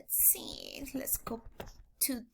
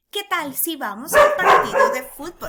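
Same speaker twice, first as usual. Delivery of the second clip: louder second take; 6 semitones higher, 11 dB louder than usual. Shown as a demonstration. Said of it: "One more time".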